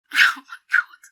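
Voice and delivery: breathless, barely voiced dismay; horrified tearful whisper. Friend horrified by her own news, whispering tearfully. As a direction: whisper it, overcome with horror.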